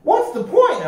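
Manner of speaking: very high, squeaky complaining cry. A narrator's reading. A pirate crying out in dismay to his mates, squeaky. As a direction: whine in dismay. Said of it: "a man cried out".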